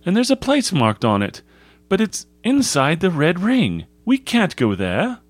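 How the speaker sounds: put-on high-pitched boy raising an objection; cautious schoolboy. Cautious scout's voice in dialogue.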